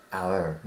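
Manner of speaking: "our" with a gliding OW into R; pronounced "OW-er". Shown as a demonstration. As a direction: make "our" sound like "hour". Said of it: "یه همچین حالتی".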